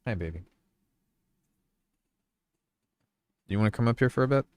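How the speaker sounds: soft, high cooing at the puppy. Real, not acted. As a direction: coo softly to a dog. Talking to the dog.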